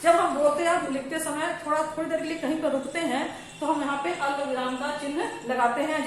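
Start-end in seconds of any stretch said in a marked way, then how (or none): none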